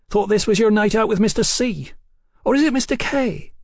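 put-on high-pitched, nosy girlfriend; pry playfully in a high, lilting voice; high teasing voice of a gossiping friend; teasing Hetty. A narrator's reading. Hetty teases Sybil.

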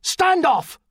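high, loud barked warning; sharp shout across the deck; barked order. A narrator's reading. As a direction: bark it as a command to keep back. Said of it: "his mother barked".

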